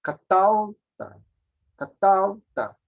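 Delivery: Catalta slowed, last syllable ta carrying the weight; stress on the last syllable. Shown as a demonstration. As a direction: slowly lean on the ending of Catalta. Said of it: "a força tá aqui".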